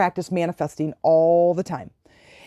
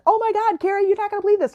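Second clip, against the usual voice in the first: calling to her friend, much higher and thrilled; bursting with the news, voice up high; excited high call; 14 semitones higher, faster than usual. Retold or imitated. Telling her friend Carrie about the red toys, high and thrilled.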